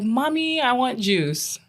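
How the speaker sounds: put-on high, light child's voice, asking sweetly; a small kid asking mom for juice; little boy voice. Son's first words, voiced.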